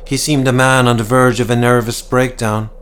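ordinary delivery

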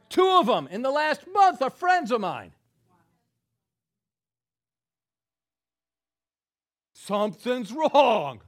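loud, high preaching at full force; fiery preaching. Acted. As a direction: preach it out to the crowd.

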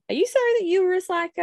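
high, quick mock-scolding question; playful tease. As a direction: poke fun at the kid. Teasing her son back.

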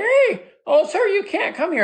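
very high-pitched young boy imitation; prepubescent teenage boy. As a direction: squeak like a boy before puberty. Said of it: "hasn't reached puberty in his voice".

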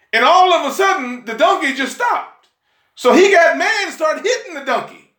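high, building pulpit storytelling; worked-up preacher telling a wonder; excited preaching. Real, not acted.

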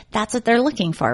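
normal talking voice

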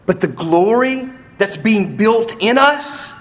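triumphant preaching. Real, not acted. Preacher quoting Paul on coming glory, soaring proclamation. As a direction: declaim the scripture, pitch climbing high.

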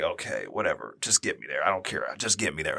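low, soft grumble; weary resignation. Retold or imitated. Passenger in pain to his driving wife, low resigned muttering. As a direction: give in under your breath, low and quiet. Pained passenger gives in to driver.

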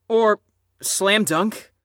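ordinary talk, nothing marked

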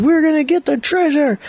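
high-pitched boyish eagerness, acted; rally your friend in a pretend fight; excited battle cry. Boy echoes the battle cry.

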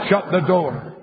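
usual speaking voice

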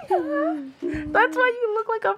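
high laughing squeal; laughing hard. Real, not acted. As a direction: laugh through the words. Laughing at red face.

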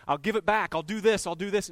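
mock-eager Zacchaeus bargaining; eager pleading. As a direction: rush the promises like a desperate man. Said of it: "Zacchaeus saying".

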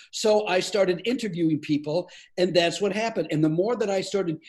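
normal talking voice

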